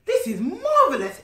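high, loud joyful exclaim; delighted exclaim. Acted. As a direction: gush over the feast. Clarence delighted at feast.

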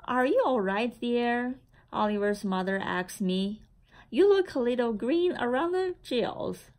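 usual speaking voice

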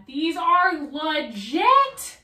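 slow, loud, amazed drawl. Real, not acted. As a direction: stretch it out, impressed. Amazed by the shoes.

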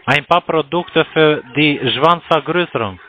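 usual speaking voice

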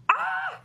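very high, soft squeal; delighted squeal. Real, not acted. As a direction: let out a tiny thrilled squeak. Delighted at gifted ring.

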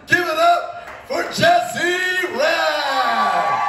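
high, drawn-out booming ring call; lucha libre announcer. Pretend. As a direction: shout the name to the back row. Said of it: "did not expect a pro wrestling entrance".

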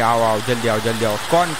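ordinary delivery

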